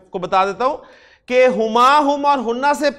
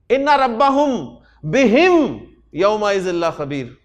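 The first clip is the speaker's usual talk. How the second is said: Quranic recitation. Shown as a demonstration. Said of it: "Quran की example".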